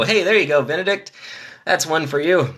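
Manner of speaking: ordinary delivery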